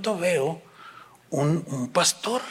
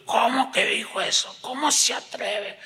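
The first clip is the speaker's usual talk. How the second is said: higher-pitched, mock-outraged reaction; indignant boss; 6 semitones higher than usual. Boss hearing what the employee said, mock indignant.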